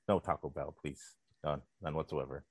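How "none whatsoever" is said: ordinary talk, nothing marked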